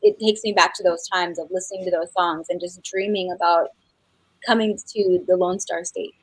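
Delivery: ordinary delivery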